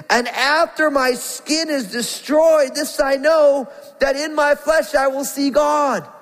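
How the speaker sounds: high, full preaching cry of hope; fervent proclamation. Acted. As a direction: proclaim it like a triumphant shout of faith. Verse re-read with rising fervour.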